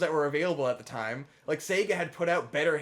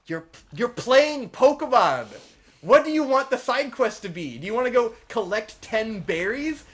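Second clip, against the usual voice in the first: exasperated retort; 5 semitones higher, 6 dB louder than usual. Real, not acted. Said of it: "That's, that's my rant".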